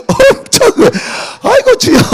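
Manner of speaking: very high, loud cry of amazement; amazed outcry. Real, not acted. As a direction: cry out in wonder. Awed exclamation.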